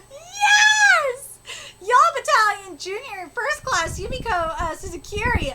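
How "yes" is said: high, loud, triumphant yes; gleeful shout of approval; delighted cheer. Real, not acted. Cheering Yumiko's comeback.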